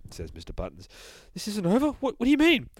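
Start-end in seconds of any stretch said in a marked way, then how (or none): 1.3-2.7 much higher, fussy relieved voice; prim narrator. Acted. Narrator relieved after the boogeyman dies, high and fussy. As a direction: fluster with relief.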